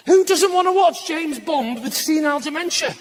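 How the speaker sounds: ordinary talk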